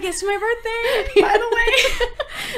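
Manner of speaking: high, slow, laughing sing-song; hint at it in a sweet, teasing voice; sing-song birthday hint. Retold or imitated.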